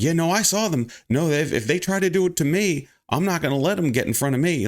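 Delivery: mildly heated queue chatter; retold loud complaining. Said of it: "we were getting really loud".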